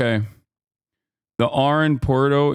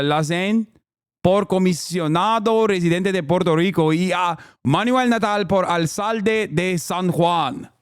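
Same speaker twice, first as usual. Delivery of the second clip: trying Spanish; 3 semitones higher than usual. Shown as a demonstration. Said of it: "I'm gonna try".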